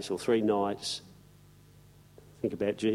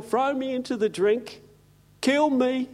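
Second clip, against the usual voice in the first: whiny Jonah; 11 semitones higher than usual. Retold or imitated. Jonah's pity party.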